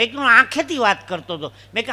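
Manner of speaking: ordinary talk, nothing marked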